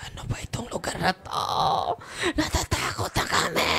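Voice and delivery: frightened visitors. Retold or imitated. Visitors asking the priest about this place, high and frightened. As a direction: mimic the scared visitors in a thin, high voice.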